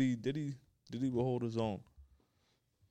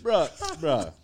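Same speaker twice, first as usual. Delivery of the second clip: tipsy bar argument; 5 semitones higher, 11 dB louder, slower than usual. Real, not acted. Heated argument over rap catalogs.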